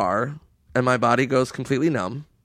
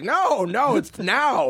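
high, slow dopey guard voice; drawn-out sleepy guard; mock security guard; 9 semitones higher, much slower than usual. Guard telling them the pool's closed, dopey and slow.

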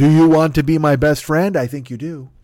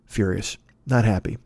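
much lower, softer deadpan; 7 semitones lower, 4 dB quieter than usual. Acted. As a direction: state it dead flat. Deadpan summary of his mood.

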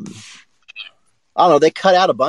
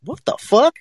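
baffled; 10 semitones higher, slower than usual. Retold or imitated. Group baffled by rude judge.

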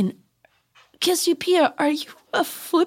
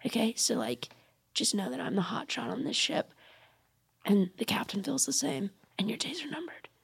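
low, very soft warning; whispered threat; 7 semitones lower, 12 dB quieter than usual. Acted. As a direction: warn your rival under your breath.

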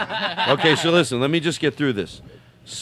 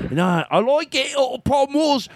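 higher, mock Australian friend; Australian accent; 9 semitones higher than usual. The friend answering Andrew, hammed-up Australian accent. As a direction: play the mate in a silly Aussie voice. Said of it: "I'll be his friend".

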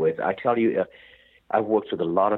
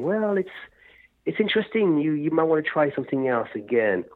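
mock polite and tactful, pitched well up; softening bad news with sugary tact; diplomatic; 8 semitones higher than usual. Hypothetical producer gently steering a singer, mock diplomatic. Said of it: "instead of being diplomatic and saying".

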